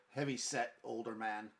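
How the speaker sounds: normal talking voice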